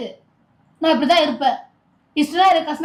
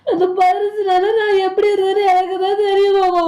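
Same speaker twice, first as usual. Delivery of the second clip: tearful, half laughing through sobs; crying. Acted. Wife telling her husband how the days apart hurt, crying. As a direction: cry and laugh at once. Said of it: "சரி, அழுவாதல".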